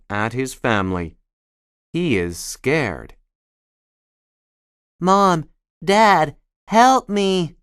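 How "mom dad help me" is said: high, loud frightened cry; shouting for help. A narrator's reading. Scared baby sloth shouting up to his parents. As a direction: call up to your parents in fear. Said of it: "he shouts".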